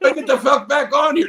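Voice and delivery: jabbing at buttons in a retold panic; frantic mock panic. Guest scrambling to rejoin the stream, high and frantic. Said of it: "I'm pushing all these fucking buttons".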